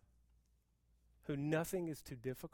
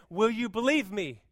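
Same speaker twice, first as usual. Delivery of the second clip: louder, higher voice of God asking; God's loud voice; 5 semitones higher, 10 dB louder than usual. Retold or imitated. God challenging his people, a loud question. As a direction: ask it boldly, as God would. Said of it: "He says".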